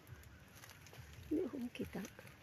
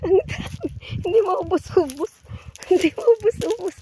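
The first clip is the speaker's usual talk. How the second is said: higher and louder, marvelling; amazed; 8 semitones higher, 7 dB louder than usual. Real, not acted. Seeing the heavy fruit, she cries out in amazement. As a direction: exclaim at how much fruit there is.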